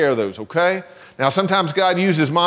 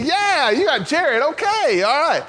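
higher, slow playful reply; feed her a cheerful line, pitched up; prompted mock cheer; 11 semitones higher than usual.

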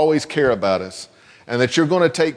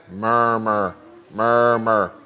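murmur demo; 6 semitones lower, much slower than usual. Shown as a demonstration. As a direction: murmur said low and rumbly. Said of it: "Can you say that?".

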